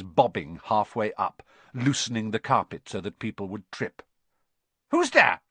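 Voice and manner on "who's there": high, loud sudden challenge; suspicious poltergeist. A narrator's reading. Peeves sensing intruders on the stairs, sudden challenge. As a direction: snap out a high-pitched challenge. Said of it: "he said suddenly".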